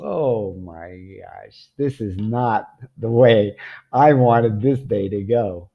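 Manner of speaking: slow, drawn-out comic despair; mock dismay. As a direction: groan it out slowly, as if the day is ruined. Dismay at losing the mango.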